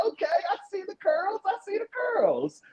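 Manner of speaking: high, laughing teasing quote. Retold or imitated. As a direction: tease through a laugh. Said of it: "I was like".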